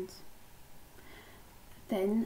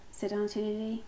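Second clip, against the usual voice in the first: ordinary delivery, nothing marked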